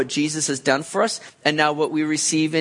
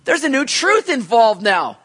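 much higher, louder burst of delight; joyful surprise; 8 semitones higher, 6 dB louder than usual. Retold or imitated. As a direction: gasp with glad surprise. Suddenly it's like.

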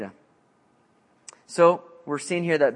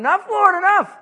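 much higher, louder desperate cry; Habakkuk begging; 7 dB louder than usual. Acted. Habakkuk crying out to the Lord, high and desperate. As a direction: beg him to stop, voice up high. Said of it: "Habakkuk's going".

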